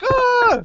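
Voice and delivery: high-pitched fanboy scream, re-enacted; excited scream. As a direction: let out a high, loud mock scream. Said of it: "And I'm like".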